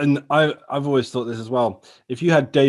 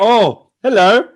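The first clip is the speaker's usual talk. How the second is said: laughing; 7 semitones higher, 4 dB louder, much slower than usual. Real, not acted. Greeting the dog, laughing.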